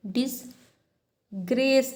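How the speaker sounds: "Disgrace" with "डिस" set apart, weight on "grace"; middle-syllable stress. Shown as a demonstration. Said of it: "पहले में नहीं है". ("Disgrace" के "grace" पर ज़ोर)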